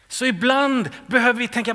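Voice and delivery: normal talking voice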